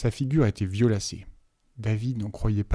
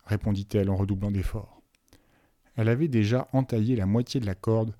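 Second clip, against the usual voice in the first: plain reading voice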